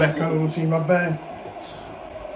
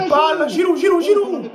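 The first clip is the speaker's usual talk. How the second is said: breathless italian goal commentary; 8 semitones higher, 4 dB louder than usual. Real, not acted. Live chance for Giroud.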